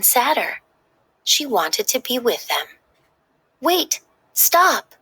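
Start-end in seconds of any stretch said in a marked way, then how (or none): 3.6-5.0 high, loud urgent call; shouting. Acted. Riley shouting to the bus driver to stop, high and loud. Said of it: "Riley shouted to the bus driver".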